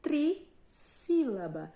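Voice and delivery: "trissílaba" high, loud and slow, split after "tri"; teacher stretching out a new term; slow term reveal. Shown as a demonstration. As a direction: announce "trissílaba" in two emphatic pieces. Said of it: "vai se chamar".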